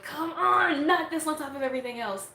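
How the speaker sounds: mock fed-up groan at a broken setup; frustrated. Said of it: "I am so frustrated with my computer".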